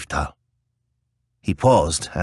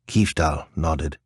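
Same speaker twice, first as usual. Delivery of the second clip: ordinary delivery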